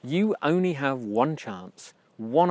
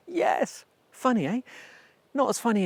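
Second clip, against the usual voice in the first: playful high yes; 10 semitones higher than usual. Real, not acted. Joking about own name.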